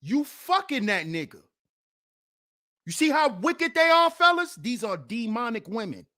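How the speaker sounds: usual speaking voice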